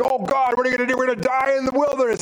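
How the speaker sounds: high, hurried mock wail; mock panic. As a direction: imitate a crowd complaining in alarm. Voicing panicked complaint of thirsty Israelites.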